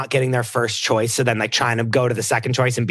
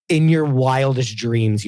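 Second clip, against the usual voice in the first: ordinary delivery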